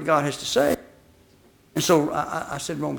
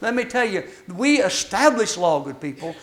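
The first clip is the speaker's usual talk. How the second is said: ordinary talk, nothing marked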